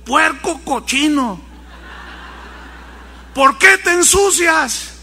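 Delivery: slow, rising scolding of a dirty pig; exasperated boy scolding his pet. Retold or imitated. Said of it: "lo regañó".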